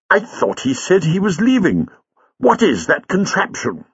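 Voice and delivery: high-pitched, baffled professor; bewildered, voice pitched well up; astonished. A narrator's reading. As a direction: question it in astonishment. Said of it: "Rather astonished by this abrupt disappearance".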